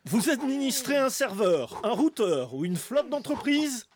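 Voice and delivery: ad announcer voice. Acted. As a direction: sell it like a TV ad. Fake sports federation ad.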